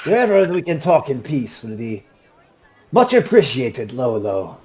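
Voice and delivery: put-on high, smooth Puss in Boots style accent; courteous request in a lilting character accent; gracious gentleman in a put-on accent, easing down at the end; Pinto Esteban. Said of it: "This is the same accent I used".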